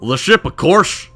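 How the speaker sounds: slow dwarf in rough Scottish; attempted Scottish accent. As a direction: answer slowly in an imperfect Scottish brogue. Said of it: "this Scottish accent down one day".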